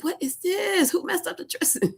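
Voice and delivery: very high, squeaky, indignant what-is-this; mock outraged squeal. Complaining at home that the dressing was messed up, high indignant squeal. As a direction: squeal like the dressing betrayed you.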